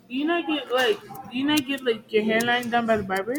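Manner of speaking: usual speaking voice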